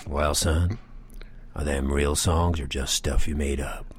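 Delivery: low voice of a big bar regular; deep, older man's voice; gruff old biker. Retold or imitated. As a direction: drop down deep, like a Santa-biker stranger. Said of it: "Compared to his voice".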